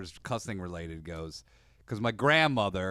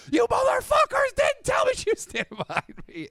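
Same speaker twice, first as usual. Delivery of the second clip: high furious shout; dad shouting; 6 dB louder than usual. Retold or imitated. Dad scolding sons after grandmother leaves, high shout. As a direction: yell it like a busted dad. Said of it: "he goes".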